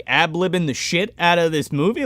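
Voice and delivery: ordinary talk, nothing marked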